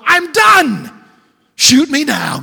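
full, high shout of someone at the end of his rope; overwhelmed outcry. Acted. Elijah burned out, crying out to God in a shout. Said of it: "and we go".

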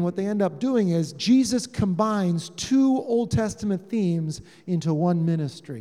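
normal talking voice

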